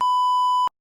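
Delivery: sudden high-pitched burst of laughter. Real, not acted. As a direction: laugh out loud at the funny mispronunciation. Friend mangles word math.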